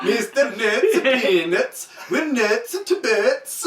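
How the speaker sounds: bad Swedish accent, slow; hammed-up Swedish accent. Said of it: "that terrible Swedish accent".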